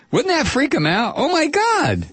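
very high, delighted exclamation; gleeful excitement. Real, not acted. Host delighted at freaking out the hecklers, gleefully excited.

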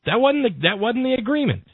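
high, indignant protest; betrayed conservative. Retold or imitated. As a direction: pitch way up, mock outrage. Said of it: "the real conservatives are out there going".

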